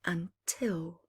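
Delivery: lively read-aloud with bouncing rhyme; rhyming story narration. A narrator's reading. Reading a rhyming bedtime book to children, lively. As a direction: tell it to children, full of drama.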